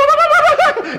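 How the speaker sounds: very high, loud burst of laughter; high cackling laugh. Real, not acted. Laughing while retelling the chicken gag, high cackle. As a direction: cackle at the memory.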